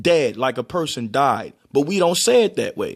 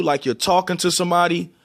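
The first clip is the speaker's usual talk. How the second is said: normal talking voice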